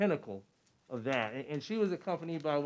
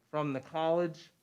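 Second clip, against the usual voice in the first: normal talking voice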